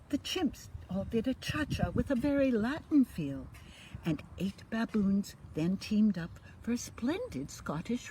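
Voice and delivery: lively storybook read-aloud; reading to children. A narrator's reading. Grandma reading a bedtime story, lively and sing-song. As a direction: bring the rhymes alive for little ones. Reads picture book to children.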